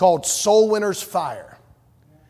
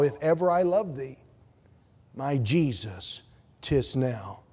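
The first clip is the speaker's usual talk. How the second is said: tender hymn recitation; 4 semitones lower, 4 dB quieter than usual. Acted. Preacher to congregation, hushed tender hymn recital. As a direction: say it quietly like a prayer.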